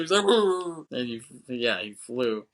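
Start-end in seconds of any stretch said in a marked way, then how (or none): 0.3-0.9 high, loud "woo"; imitated flying yell. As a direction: mimic someone blasted backwards through the air. Imitates man blown back by shot.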